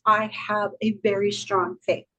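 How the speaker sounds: normal talking voice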